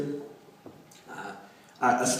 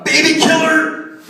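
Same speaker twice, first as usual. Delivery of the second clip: hammed-up screaming accusation, much higher and louder, drawn out slow; furious family yell; 15 dB louder, slower than usual. Relative at Christmas dinner screaming at the scientist, furious.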